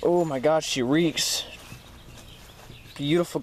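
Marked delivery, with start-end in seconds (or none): none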